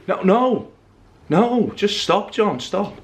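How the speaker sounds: normal talking voice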